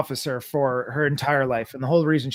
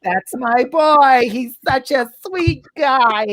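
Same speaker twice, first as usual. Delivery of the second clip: proud mom; 10 semitones higher, 7 dB louder, slower than usual. Retold or imitated. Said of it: "starts making all the lovely".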